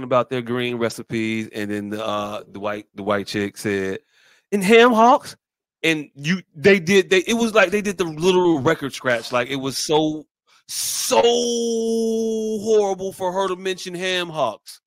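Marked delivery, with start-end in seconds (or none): 4.5-5.4 raised, louder question; higher, loud quoted question; retold questioning. 10.7-14.3 "so" held very long; slow, long-held SO, then carry on; drawn-out emphasis. Real, not acted. Dramatic stretched reaction to retold moment.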